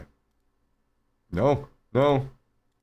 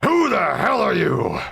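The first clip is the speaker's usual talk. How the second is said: higher, slow, loud challenge from a grumpy duck; gruff duck voice; 9 semitones higher, 4 dB louder, slower than usual. Acted. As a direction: demand it like an offended duck.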